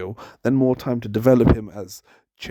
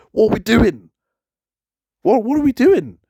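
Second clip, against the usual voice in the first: exasperated outcry; 8 semitones higher, 4 dB louder than usual. Real, not acted. Exasperated rant about wrestling booking.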